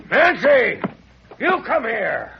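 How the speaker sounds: loud, high call across the yard. Acted. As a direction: yell her name to bring her out. Father calls daughter from outside.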